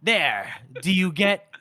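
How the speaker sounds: slow, lightly acted villain's one-liner; smug clever-line delivery. Swordsman gives his foe the clever last line, slow and hammy. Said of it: "gives him the clever last line".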